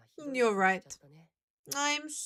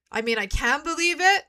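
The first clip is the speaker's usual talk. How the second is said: ordinary delivery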